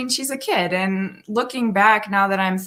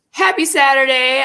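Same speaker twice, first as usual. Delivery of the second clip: higher, louder cheery hello; cheery greeting; 6 semitones higher, 7 dB louder than usual. Real, not acted. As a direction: burst in with a bright welcome. Restarting greeting once live.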